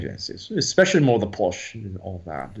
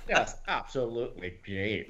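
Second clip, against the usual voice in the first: softer Scottish impression; Scottish accent; 10 dB quieter than usual. Shown as a demonstration. Showing off a favourite accent, softer Scottish. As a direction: say it quietly in Scottish. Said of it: "I just love doing the Scottish one".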